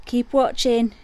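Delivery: ordinary talk, nothing marked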